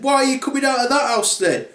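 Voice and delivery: high, fast, indignant question; questioning bears. Acted. Bears questioning the girl about the house, indignant. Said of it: "The three bears then said".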